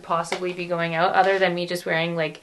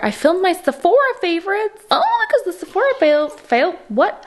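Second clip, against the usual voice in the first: excited flub; 11 semitones higher, 4 dB louder than usual. Real, not acted. Flubs Sephora sale, laughs at self.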